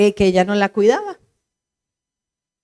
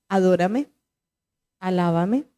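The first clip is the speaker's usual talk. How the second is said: gentle command; 5 semitones lower, 5 dB quieter than usual. Retold or imitated. God speaks softly.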